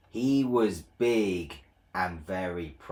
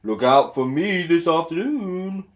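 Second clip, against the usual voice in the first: higher, loud proud call; boastful Gordon; 6 semitones higher, 7 dB louder than usual. Acted. Proud engine teasing little Edward. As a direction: crow it over your shoulder. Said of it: "said Gordon".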